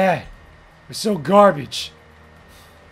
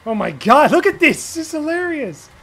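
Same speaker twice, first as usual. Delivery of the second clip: high, loud disbelief; raging gamer; 8 semitones higher, 4 dB louder than usual. Real, not acted. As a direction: yelp it out in amazement. Laughing at lag.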